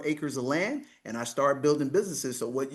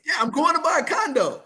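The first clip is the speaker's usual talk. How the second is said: emphatic; 12 semitones higher, 6 dB louder than usual. Real, not acted. Emphatic retelling.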